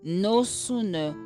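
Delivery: ordinary talk, nothing marked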